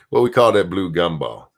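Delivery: ordinary delivery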